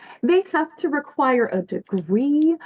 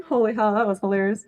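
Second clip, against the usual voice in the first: normal talking voice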